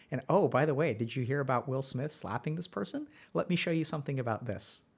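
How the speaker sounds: seductive YouTube voice. Acted. YouTube seducing a frustrated viewer, soft, low and coaxing. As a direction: lure a tired viewer in like a tempting lover. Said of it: "YouTube opens its arms and says".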